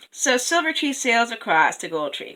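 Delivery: ordinary delivery, nothing marked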